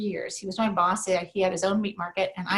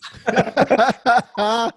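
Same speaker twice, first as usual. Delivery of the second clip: loud burst of laughter; big, loud laugh following the punchline; 9 dB louder than usual. Retold or imitated. Dad finding the rival's plan hilarious, loud laughter. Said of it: "and I'm laughing".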